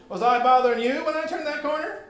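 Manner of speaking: loud indignant question; indignant challenge. Acted. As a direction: confront the boy indignantly. Woman challenges boy.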